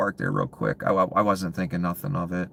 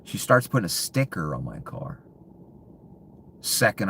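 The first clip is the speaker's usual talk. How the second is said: ordinary talk, nothing marked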